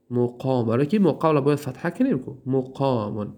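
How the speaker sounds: usual speaking voice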